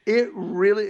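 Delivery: usual speaking voice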